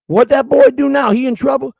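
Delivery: put-on high-pitched Southern mother asking after her boy; southern old lady. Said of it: "a nice old lady answered the door with a southern accent".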